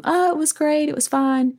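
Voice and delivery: high-pitched, placating reassurance; polite false praise. Acted. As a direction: brush it off in a high voice. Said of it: "They're just gonna go to".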